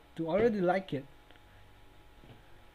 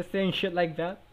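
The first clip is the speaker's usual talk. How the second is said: usual speaking voice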